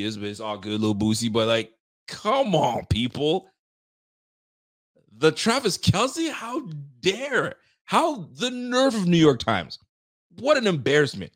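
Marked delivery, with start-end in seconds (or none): 5.2-11.3 high-pitched, drawn-out outrage; indignant disbelief. Real, not acted. Host scolding the New York Times for its nerve, indignant disbelief. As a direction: stretch it out, scandalized. Mock outrage at the newspaper article.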